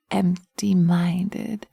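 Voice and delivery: slow breathy whisper; hushed, drawn-out whisper. Acted. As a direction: breathe it out slowly. Witch entrancing the listener.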